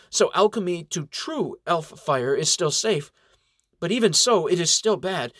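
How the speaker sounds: lightly voiced young elf in broken English, worried; Zena, worried elf girl. Zena explains Athabask fire to the reverend, worried.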